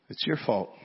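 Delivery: low, muted accusation as a private thought; unspoken blame. Retold or imitated. Pastor silently blaming his colleague at the table, muted accusation. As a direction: think it aloud softly, blaming your friend. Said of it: "That's what I'm thinking".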